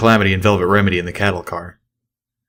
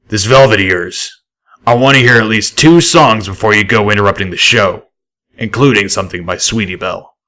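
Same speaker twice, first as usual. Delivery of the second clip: a lightly voiced raider boss, louder; gruff boss Deadeye; 4 dB louder than usual. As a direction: read the raider boss a little rough and loud.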